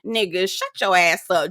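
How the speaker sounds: ordinary delivery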